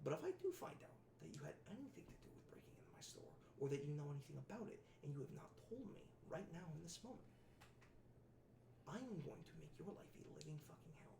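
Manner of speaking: low hissed whispered threat, leaning in close; menacing whisper. Acted. Con man whispers a threat to the kid, menacing whisper. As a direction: breathe the threat right in the kid's ear. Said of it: "leans forward and goes".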